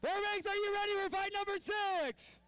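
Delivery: shouted, high crowd hype; rally speech. Real, not acted. Crowd hype, repeated louder.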